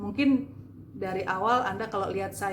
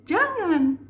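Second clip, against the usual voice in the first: high, loud forceful prohibition; angry intonation; 10 semitones higher, 8 dB louder than usual. Shown as a demonstration. Coach demonstrating intonation again, angry high shout. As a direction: belt it out as an angry order. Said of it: "juga intonasi".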